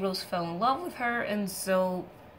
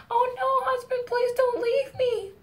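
much higher, louder, mock-desperate wail; desperate pleading; 6 dB louder than usual. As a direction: beg him not to go, hammed up. Psyche begs Eros to stay.

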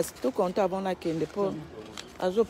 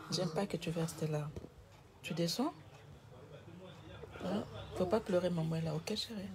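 gentle comforting; 8 dB quieter than usual. Real, not acted. Mother hushing her crying daughter, soft and reassuring. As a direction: coax her quietly to stop crying.

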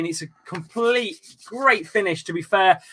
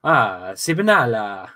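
lower, hammed-up Italian accent; playful Italian accent on request; 7 semitones lower, slower than usual. Shown as a demonstration. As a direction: say it like an Italian waiter. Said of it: "say Sibnala in an Italian accent".

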